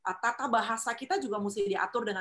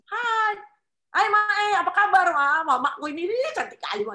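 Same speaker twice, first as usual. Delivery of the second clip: cheerful greeting; 10 semitones higher, 9 dB louder than usual. Shown as a demonstration. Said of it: "mukamu jangan murung".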